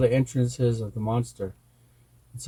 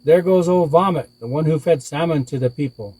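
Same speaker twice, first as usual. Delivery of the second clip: loud, raised-pitch comeback, easing at the end; shouted retort; 5 semitones higher, 6 dB louder than usual. A narrator's reading. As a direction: shout it back as defiant Coyote. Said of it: "shouted back to the monster".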